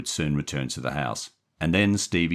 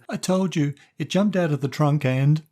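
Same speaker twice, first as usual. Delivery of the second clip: put-on high, loud frightened child voice; young boy; 12 semitones higher, 5 dB louder than usual. A narrator's reading. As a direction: plead like a cornered kid. Boy voice Stevie.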